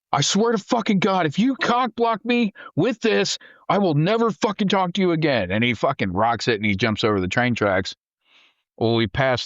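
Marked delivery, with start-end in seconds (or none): 0.1-5.5 pitched-up mock rage; retold angry threat. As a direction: threaten the driver in frustration. Threatening driver, getting pissed.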